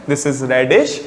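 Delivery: ordinary talk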